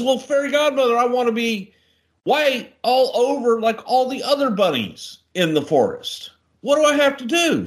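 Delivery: high, mock little bunny voice; high pink bunny begging the fairy godmother; pleading bunny.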